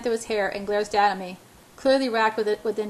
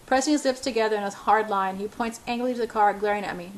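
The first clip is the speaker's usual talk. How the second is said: usual speaking voice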